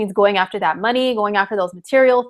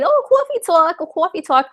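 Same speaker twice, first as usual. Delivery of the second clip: put-on much higher, brassy New York Joan Rivers accent; 8 semitones higher than usual. Astrologer greeting her stream as Joan Rivers, New York accent. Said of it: "only because I loved Joan Rivers as a kid, and I love accents".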